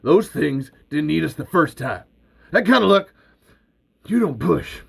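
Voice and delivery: high, loud eager push; excited pleading. Acted. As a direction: sell the dream to Earl. Val pushing Earl, elated.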